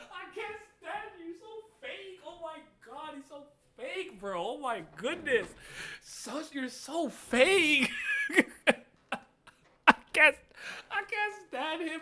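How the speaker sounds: very high squealing disbelief, laughing; outraged fan rant. Real, not acted. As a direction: rant in shrill disbelief at the villain. Outraged fan ranting at character.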